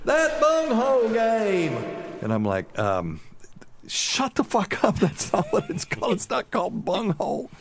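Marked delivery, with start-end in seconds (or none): 0.0-1.7 slow, very high mock holler; drawn-out comic yell, pitched way up. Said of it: "she yells". 3.9-7.5 high, strained mock scolding; hammed-up embarrassment, pitched way up; mortified correction. Retold mortified correction in public.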